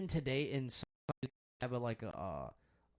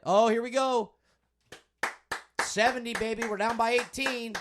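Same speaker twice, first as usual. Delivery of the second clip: louder and higher, hyped up over the score; excited game calling; 8 semitones higher, 8 dB louder than usual. Real, not acted. Fan yelling at the basketball game on TV, excited. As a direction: call it like a fan pumped at the screen.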